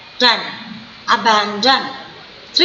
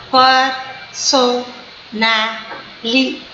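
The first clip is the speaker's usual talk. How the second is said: "personality" said slowly, one syllable at a time; slow syllable-count demo of "personality"; 2 semitones lower, much slower than usual. Shown as a demonstration. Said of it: "it is five syllable word".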